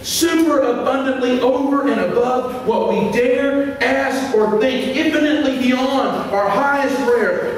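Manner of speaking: high-pitched, emphatic preacher delivery; fervent sermon in full flow; impassioned preaching. Real, not acted. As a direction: preach it with urgency, stretching key words.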